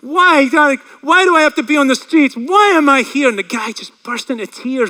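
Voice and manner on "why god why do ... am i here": high, loud anguished plea, acted; retold cry of abandonment; crying out to God. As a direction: call out desperately to heaven. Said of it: "crying out to God".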